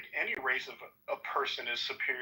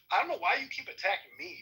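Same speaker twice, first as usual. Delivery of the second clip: ordinary talk, nothing marked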